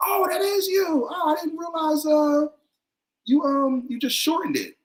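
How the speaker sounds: a high falsetto of surprise; surprised high voice. Retold or imitated. Surprised newcomers recognizing him.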